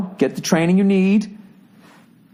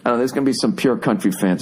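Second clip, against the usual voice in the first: ordinary delivery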